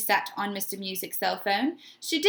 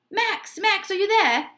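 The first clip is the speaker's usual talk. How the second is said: high, raised voice calling from a phone; 9 semitones higher, 5 dB louder than usual. A narrator's reading. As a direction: call out through a phone speaker. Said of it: "came the voice from the phone".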